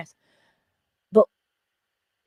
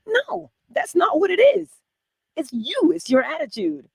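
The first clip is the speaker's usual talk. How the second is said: higher and louder, cutting it off hard; emphatic outburst; 11 semitones higher, 9 dB louder than usual. Real, not acted. Speaker to listeners, insisting it is their attitude, loud and high. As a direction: shut down the excuse with force. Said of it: "emphatic correction".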